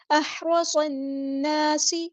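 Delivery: أَحْرَصَ النَّاسِ slowly, clear hamza and heavy ر; slow Quranic chant of أَحْرَصَ النَّاسِ; tajweed recitation. Shown as a demonstration. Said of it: "اس کی آواز واضح ادا کرنی ہے".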